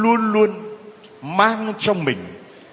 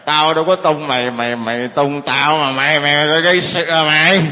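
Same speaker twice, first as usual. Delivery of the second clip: put-on slurred drunken challenge, swelling louder at the end; hammed-up drunk picking a fight, words running together; 2 semitones lower, 4 dB louder than usual. Drunk driver accusing the man he hit, slurring. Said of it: "Rõ ràng mình xỉn".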